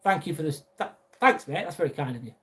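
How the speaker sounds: normal talking voice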